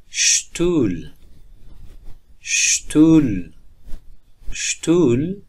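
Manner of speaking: شتول with sh-t onset, stretched; st said as sht. Shown as a demonstration.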